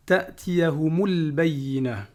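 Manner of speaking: ta'tiyahumul bayyina slow, joined as one; linked words. Shown as a demonstration. Teacher showing the liaison, slow and joined. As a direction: glide the mim into al-bayyina. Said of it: "On fait la liaison ici".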